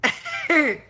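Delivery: high, loud burst of laughter. Real, not acted. Reacting to viewer comments.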